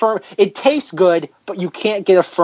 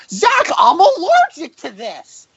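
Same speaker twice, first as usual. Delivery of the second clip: high, slow indignant whine; mock whiny friend; 10 semitones higher, slower than usual. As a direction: whine it like a put-upon friend. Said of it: "and Rob's like".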